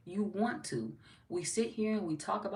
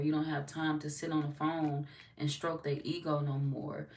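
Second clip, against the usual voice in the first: usual speaking voice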